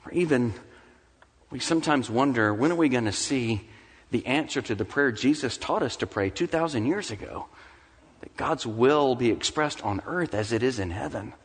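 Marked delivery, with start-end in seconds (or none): none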